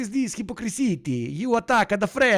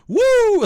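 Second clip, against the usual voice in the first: high, loud celebratory woo; high whooping cheer; excited whoop; 8 dB louder than usual. Real, not acted. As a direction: whoop like the team just scored. Cheering at the clip.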